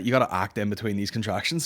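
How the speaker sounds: normal talking voice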